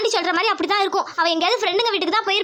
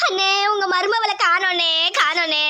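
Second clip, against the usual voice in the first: slow, drawn-out mock wail; keen theatrically for a missing daughter; put-on crying; 3 semitones lower, slower than usual. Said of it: "அழுவாம". (இழுத்து இழுத்து போலியாக ஒப்பாரி)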